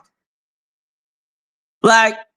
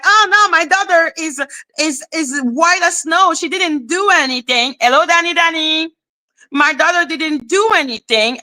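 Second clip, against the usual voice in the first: higher-pitched mock defensive parent; a hint of oblivious parent, pitched up; 6 semitones higher than usual. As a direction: lightly imitate a parent protesting a child's innocence. Mimicking a parent excusing her child.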